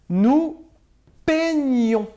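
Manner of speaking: Nous peignons called out high and loud; emphatic dictation. Shown as a demonstration. Teacher correcting homework, loud emphatic answer. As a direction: stress Nous, then land on peignons. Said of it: "C'est le son gneu qu'il fallait pas oublier".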